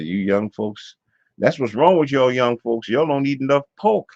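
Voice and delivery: loud, high Southern scolding; Southern accent. Retold or imitated. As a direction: imitate a Southern relative scolding the young. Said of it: "he said it in, like, this Southern accent".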